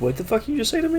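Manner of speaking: high, soft squeal of fake offence; mock outrage. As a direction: act offended in a small, high voice. Mock outrage of someone being teased.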